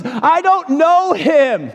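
high, slow, strained denial; desperate denial. Acted. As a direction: push the lie out desperately. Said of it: "And he says".

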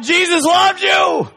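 high, loud, slow shout of joy; yelled slow and high, ringing out. Retold or imitated. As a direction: belt it into the mailbox. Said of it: "And I yelled".

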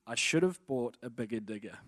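"should have bought a bigger digger" word by word; slowed down. Retold or imitated. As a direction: spell out "bigger digger" slowly and separately. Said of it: "I'll slow it down".

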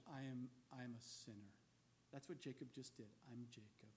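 low and soft, fading away; hushed confession. Retold or imitated. Jacob confessing to God he is a sinner, hushed. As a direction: confess it quietly, ashamed.

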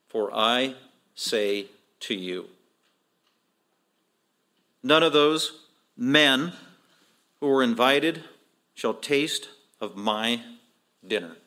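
very slow, measured scripture quotation; grave, deliberate verdict; solemn pronouncement. Retold or imitated. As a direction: pronounce judgment word by word, with long pauses. Solemn reading of Jesus' verdict.

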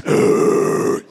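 very loud mock growl; long, hammed-up disgusted groan; mad groan. Mimicking women who came to church mad, loud grouchy groan. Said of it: "some of you women today woke up mad".

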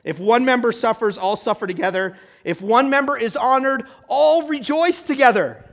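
scripture proclamation. Acted. Reads scripture aloud.

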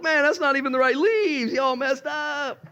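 very high, exasperated voice; hammed-up incredulous complaint; comically scolding God. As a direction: scold them in high-pitched disbelief. God comically scolding over leaves.

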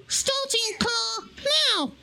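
very high, slow squeaky voice; squeaky puppet voice. Pretend. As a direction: squeak it out slowly. Puppet voice robotic reply.

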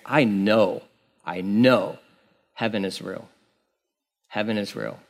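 low, very soft retold testimony; hushed conviction. Friend testifying after near death, hushed conviction. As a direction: let it drop almost to nothing, low and certain.

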